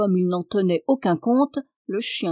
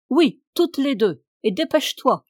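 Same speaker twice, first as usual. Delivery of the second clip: louder, higher impatient call; shouting back up; 5 semitones higher, 6 dB louder than usual. A narrator's reading. Master below answers servant at window, shouting back. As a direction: call back up, urging haste.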